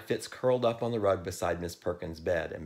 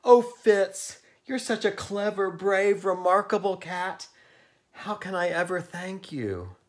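high, loud, gushing praise; storybook exclamation, pitched way up; Miss Perkins crying out; 12 semitones higher, 8 dB louder than usual. A narrator's reading. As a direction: gush over the brave cat at full voice. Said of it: "cried Miss Perkins".

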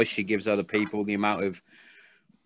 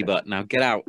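ordinary delivery

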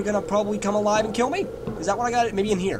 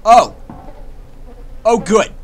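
startled gasp; 2 semitones higher, 8 dB louder, slower than usual. Real, not acted. Jump scare in vent.

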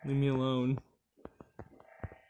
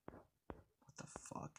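slow breathy whisper of disbelief; 16 dB quieter, much slower than usual. Real, not acted. Player hiding from the monster, slow whisper. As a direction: barely breathe it out.

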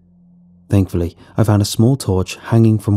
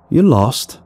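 startled cry; 4 semitones higher than usual. A narrator's reading. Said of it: "I cried out".

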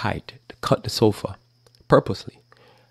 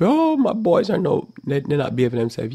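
whiny parent; 7 semitones higher than usual. Retold or imitated. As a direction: whine like a helpless parent. Mocking whiny weak parent.

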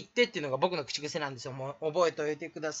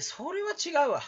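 high-pitched borrowed catchphrase; トミー catchphrase; 8 semitones higher, 3 dB quieter than usual. Acted. Streamer borrows another YouTuber's catchphrase, lightly imitated. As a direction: swoop up high for a light objection. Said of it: "トミーさんの口癖です".

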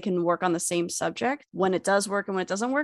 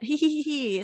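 higher, playful hee-hee-hee; mock sneaky giggle; 5 semitones higher than usual. Acting out stealing the lab cats, mock sneaky giggle. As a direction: pretend schemer's snicker.

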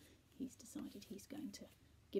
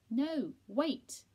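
loud, higher, punched words; raised voice, insistent; exasperated correction; 6 semitones higher, 13 dB louder than usual. A narrator's reading. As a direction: correct a deaf friend at volume. Correcting deaf Percy.

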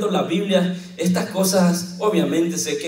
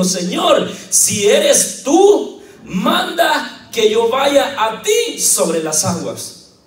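louder, slow, drawn-out plea; pleading Peter; 4 semitones higher, 5 dB louder, much slower than usual. Acted. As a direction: call out slowly to the master on the water. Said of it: "le respondió Pedro y le dijo".

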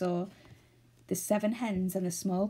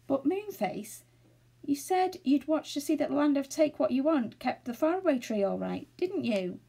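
raised-pitch worried questioning; mildly worried storybook voice; anxious; 6 semitones higher than usual. A narrator's reading. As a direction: seek reassurance, a touch anxious. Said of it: "said Silky anxiously".

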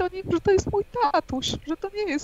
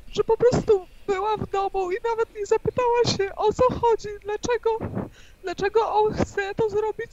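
crying; 6 semitones higher than usual. Real, not acted. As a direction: still tearful, voice high and strained. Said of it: "płacz". (płaczliwie)

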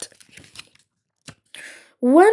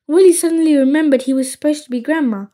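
ordinary delivery, nothing marked

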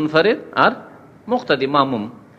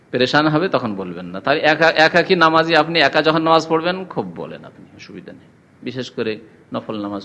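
ordinary talk

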